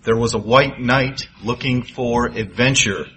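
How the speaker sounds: measured storytelling voice; story narration. A narrator's reading. Preacher tells a parable story.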